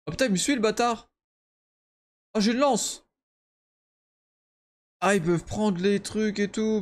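high, fast and panicky; being chased. Real, not acted. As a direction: flee in a rush. Enemy following him in game.

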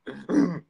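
put-on throaty laugh in short bursts; deliberately forced, stuttering chuckle; practiced new laugh. Shown as a demonstration. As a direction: try out an odd fake laugh. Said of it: "I'm practicing my new laugh".

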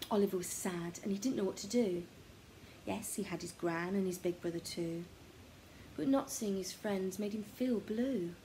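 ordinary delivery

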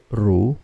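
"ru" starting low and rising; second tone. Shown as a demonstration. Teacher drilling learners in second tone, "ru" rising. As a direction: glide "ru" upward from low. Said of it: "that's a second tone".

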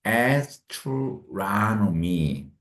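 astronomy dragged out, stress on -tro-; extremely slow stress demo. Shown as a demonstration. Teacher showing where astronomy is stressed. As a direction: pull astronomy out, leaning on the stress. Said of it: "강세는 여기에 있어요".

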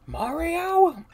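high, loud call of Mario. Acted. Character calls out to Mario, high and loud.

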